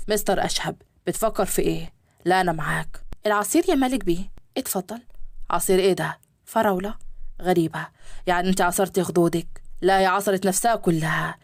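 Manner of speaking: men's voices in a read story; low male guests. Acted. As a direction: play the businessmen, voice dropped low.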